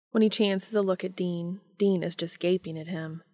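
ordinary delivery

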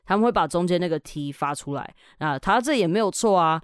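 usual speaking voice

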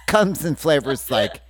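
words bubbling with laughter; giggling while pitching; laughing through it. Real, not acted. Mock ad pitch cracking up with laughter. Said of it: "Don't say comes".

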